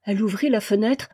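usual speaking voice

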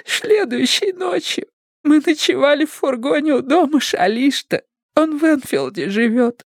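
high-pitched weepy account; tearful. A narrator's reading. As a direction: keep explaining through the tears. Stephanie still crying as she explains.

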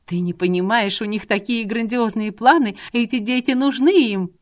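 rising manic insistence; frantic rant. Acted. Deranged woman rants at man.

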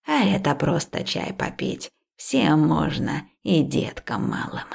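easy, unhurried elder's instruction; old herbalist. A narrator's reading. Old woman offers tea for all, slow and low.

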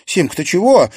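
high, loud alarmed question; frightened. A narrator's reading. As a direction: rush to her worried sister. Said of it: "испуганно спросила Марина". (испуганно, высоко)

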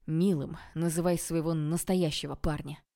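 ordinary delivery, nothing marked

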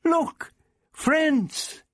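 high, urgent call; very high excited cry; yell. A narrator's reading. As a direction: call out high to stop the attack. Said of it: "Barbara yelled".

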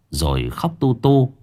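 usual speaking voice

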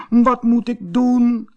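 high, slow, tearful little voice; lonely kitten crying in the cave. A narrator's reading. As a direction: plead it helplessly, near tears. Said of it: "huilde hij".

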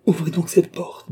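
high-pitched, insistent command to a servant; urgent order. A narrator's reading. Mother urgently orders the maid. (ordre pressant, voix haut perchée)

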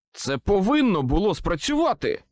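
loud frustrated outburst; snapping. A narrator's reading. Wizard snaps at failed potion, frustrated outburst. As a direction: snap out in frustration. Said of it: "Зірвався я".

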